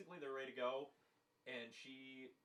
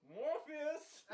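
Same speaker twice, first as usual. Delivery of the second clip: higher, calling out to Morpheus; hammed-up calling out; mock call out; 12 semitones higher, 3 dB louder than usual. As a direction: shout his name like summoning. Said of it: "she's like".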